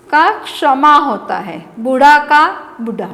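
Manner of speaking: usual speaking voice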